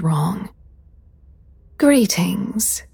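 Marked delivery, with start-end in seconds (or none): 1.8-2.8 breathy sigh. A narrator's reading. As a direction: sigh the word out airily. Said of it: "a breathy voice sighed".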